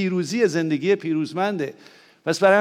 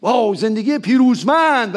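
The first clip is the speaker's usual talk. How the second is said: mock slogan; 5 semitones higher, 5 dB louder than usual.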